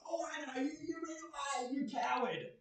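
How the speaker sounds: lightly voiced monster jeering; taunting monster. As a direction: sneer at the runaway player. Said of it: "bump into them three hours later and go".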